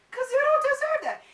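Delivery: high, loud indignant squeal; sudden shrill-high protest; indignant outburst. Real, not acted. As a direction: pitch it way up, loudly affronted. Indignant rant at nosy askers.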